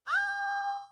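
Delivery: high, loud, sustained "Ah!"; an attempted full-body horror yell; desperate yell. Shown as a demonstration. Auditioning for a horror movie, desperate yell. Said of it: "desperate yell. Something like".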